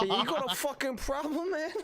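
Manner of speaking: put-on high, laughing New Jersey mafia accent; hammed-up New Jersey mafia tough guy, picking a fight. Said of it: "talking in a mafia accent".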